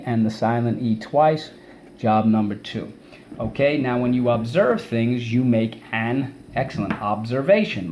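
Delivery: usual speaking voice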